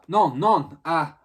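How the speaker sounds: a Frenchman yelping in fright; startled Frenchman, shouting in French; startled French cry. Acted. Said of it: "He gave a startled gasp".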